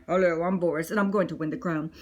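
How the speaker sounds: low bear voice; deep rival bear; big bear Boris. Acted. New big bear introduces himself.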